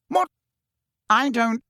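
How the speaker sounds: baffled. A narrator's reading. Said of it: "He did not understand".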